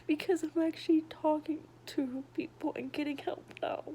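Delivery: usual speaking voice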